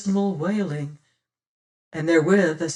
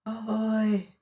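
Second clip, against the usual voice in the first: a softer, distant echo of the call; fainter echo; 4 semitones higher, 3 dB quieter than usual. A narrator's reading. The cave echo answering Martin, faint and far off. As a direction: let it fade like a far echo. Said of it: "though much fainter".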